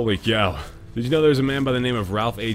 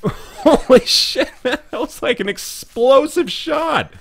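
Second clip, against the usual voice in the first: shocked laughter; 5 dB louder, slower than usual. Real, not acted. Reacting to explosive shot.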